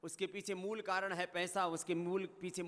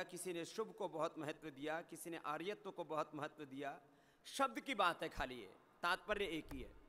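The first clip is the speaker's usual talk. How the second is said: usual speaking voice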